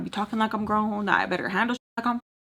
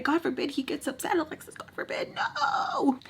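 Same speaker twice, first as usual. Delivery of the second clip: rising high, soft sarcastic; mock it up into a squeak; high fading sarcasm; sarcastic mockery; 9 semitones higher, 5 dB quieter than usual. Real, not acted.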